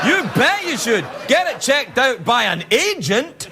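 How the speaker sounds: high shouted YOU; shouting. Acted. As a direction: yell it out emphatically. Repeated, second shouted.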